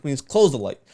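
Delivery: ordinary talk, nothing marked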